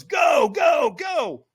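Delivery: high, loud launching yell; acted coach's holler; urgent shouted command. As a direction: send a runner off at full shout. Trainer sends him onto coals.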